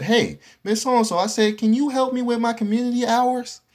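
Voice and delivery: high, sweet voice asking a favor; eager student asking teacher in high polite voice. Retold or imitated.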